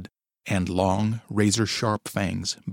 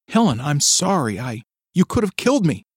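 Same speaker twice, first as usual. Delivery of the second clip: upset; 6 semitones higher, 6 dB louder than usual. A narrator's reading. Upset wife exclaiming.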